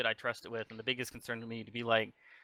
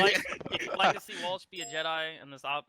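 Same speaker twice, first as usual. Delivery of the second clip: laughing while talking; 6 semitones higher than usual. Real, not acted. Joking about Walsh as Jedi.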